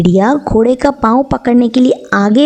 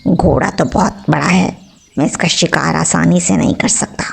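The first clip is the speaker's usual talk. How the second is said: low, hushed inner voice of a sly wolf; wolf plots quietly to himself about the horse, hushed low murmur; wolf thinking to himself; 6 semitones lower, 3 dB quieter than usual. A narrator's reading. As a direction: mutter it under the breath like a scheming wolf. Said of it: "भेड़िए ने अपने दिल में कहा".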